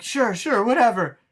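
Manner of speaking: mock scared yes-man; nervous brush-off. Charlie placating the Joker, nervous mock voice. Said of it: "And he's like".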